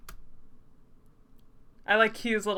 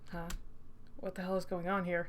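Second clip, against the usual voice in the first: usual speaking voice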